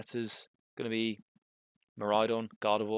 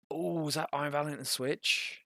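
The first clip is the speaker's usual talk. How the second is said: higher-pitched excited "ooh"; hyped reaction; 4 semitones higher than usual. Real, not acted. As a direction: perk up at a surprise play. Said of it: "why I'm getting so hyped".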